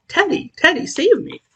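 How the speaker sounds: high echo of her call for help; a mild imitated high plea, save me; imitating her cry. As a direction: lightly mimic her frightened call. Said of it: "You called".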